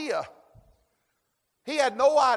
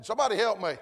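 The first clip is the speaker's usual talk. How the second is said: ordinary talk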